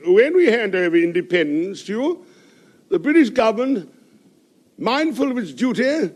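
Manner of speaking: orate like a pompous British envoy; speechifying diplomat to the crowd. Acted.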